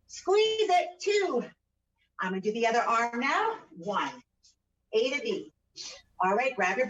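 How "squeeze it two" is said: straining effort call. Real, not acted. Coach calling out mid-exercise.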